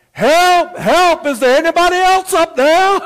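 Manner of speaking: high, loud desperate yell; full-voiced, high cry for help; hollering for help. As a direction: holler past the first voice for someone else. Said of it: "Jack started to holler out".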